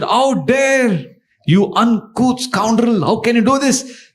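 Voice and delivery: high-pitched, drawn-out indignation; outraged master. Acted. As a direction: act out a scandalised scolding. Master finds servant in his bed.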